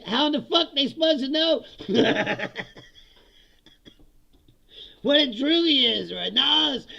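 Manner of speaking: high, loud, laughing through the words; incredulous, cracking up mid-rant; laughing disbelief. Retold or imitated. Mocking the missing glossary, laughing.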